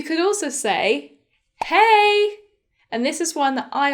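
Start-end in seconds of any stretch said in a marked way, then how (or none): 1.6-2.4 high, loud, held-out hello; friendly greeting. Shown as a demonstration. Teacher modelling an informal greeting for friends, high and loud.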